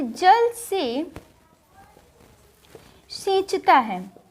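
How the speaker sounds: "जल से सींचता है" drawn out slowly, word by word with a long pause; slow dictation. Shown as a demonstration. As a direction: spell the example out slowly for students. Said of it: "इसका हम example लेते हैं कि". (धीरे-धीरे लिखवाते हुए)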